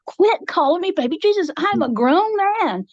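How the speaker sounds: high, exasperated mock complaint; indignant protest. Annoyed Jesus protests in retold dream.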